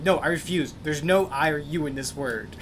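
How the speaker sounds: loud, mock-indignant protest; indignant refusal. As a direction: refuse outright, playfully exasperated. Acting out gamer refusing French pronunciation.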